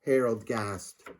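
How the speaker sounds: usual speaking voice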